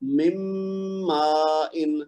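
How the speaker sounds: مم ما ان, a long held nasal mm; ادغام با غنہ; merged recitation. Shown as a demonstration. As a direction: stretch the mm of ما ان very slowly. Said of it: "تو کیا ہوگا".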